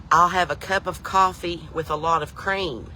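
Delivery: each word of "cup of coffee" drawn out and separated; slow, careful repeat. Retold or imitated. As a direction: slowly spell out "cup of coffee with a lot of cream". Repeats order for baffled waitress.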